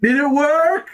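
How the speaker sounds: high, sing-out question to another room; calling out. Acted. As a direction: call to someone out of sight. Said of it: "and then call out".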